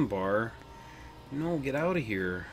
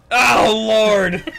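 loud cry; 8 semitones higher, 14 dB louder than usual. Real, not acted. Laughing shock at game chaos.

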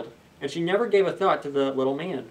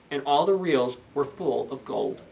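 ordinary delivery, nothing marked

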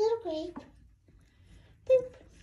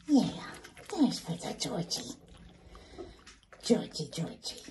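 ordinary talk

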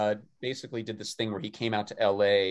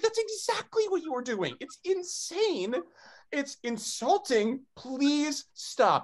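very high, drawn-out mock outrage; exasperated pleading; slower than usual. Mortified son begs dad to stop, exasperated. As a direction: act out an embarrassed son begging, stretching the key words. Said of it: "so embarrassing".

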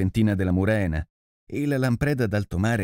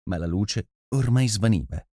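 plain reading voice

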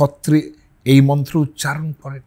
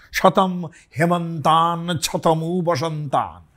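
slow Sanskrit chant; mantra recitation; 4 semitones higher, slower than usual. Shown as a demonstration. Teacher chanting a Vedic blessing, slow and measured. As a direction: intone the verse slowly.

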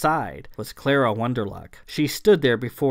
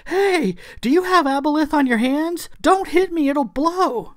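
very high, frantic and loud; panicked warning; 5 dB louder than usual. A narrator's reading. Clara warns Thomasina of aboleth on her hands, panicked. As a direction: warn urgently, voice pitched way up.

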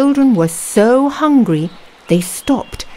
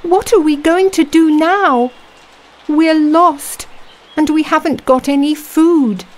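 put-on worried girl; 10 semitones higher than usual. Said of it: "said Gretel".

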